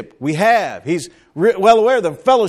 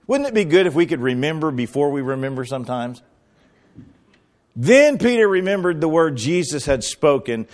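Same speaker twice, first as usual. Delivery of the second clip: ordinary delivery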